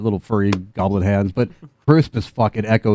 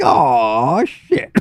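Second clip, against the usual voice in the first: surprised gangster; 4 semitones higher, 3 dB louder than usual. Acted. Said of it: "they're like".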